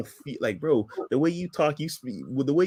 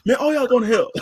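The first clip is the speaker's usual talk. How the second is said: mock zealot preacher; 6 semitones higher than usual. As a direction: ham up a preacher condemning everyone. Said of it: "they ain't saying that".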